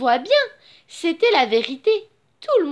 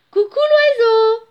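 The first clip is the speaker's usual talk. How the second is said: high, loud singsong greeting; calling out; 6 semitones higher, 6 dB louder than usual. A narrator's reading. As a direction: hail the bird from below. Mouse calls out to the owl. (appel aigu et chantant)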